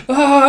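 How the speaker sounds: very high, loud yelp; hammed-up cartoon shriek; mock scream. Manga fan acts out Fiona being carried, mock scream.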